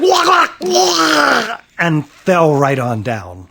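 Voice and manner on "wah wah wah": high, drawn-out strangled wail; cartoonish yelps ending in a long wail; comic cry of alarm. A narrator's reading. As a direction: holler like you've been pounced on. Father cries out when jumped on.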